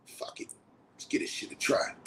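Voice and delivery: whispered resignation; a whispered decision to try monogamy. Real, not acted. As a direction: give in under your breath.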